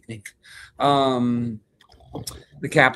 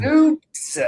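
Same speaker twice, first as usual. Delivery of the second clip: squeaky name call; 7 dB louder than usual. Real, not acted. Echoing high name call.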